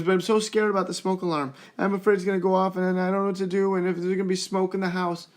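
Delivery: mock child's fearful whine; imitating a scared child. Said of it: "And she goes".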